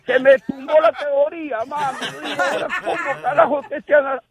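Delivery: laughing while telling it. Real, not acted. Laughing at his own theory. (hablando entre risas)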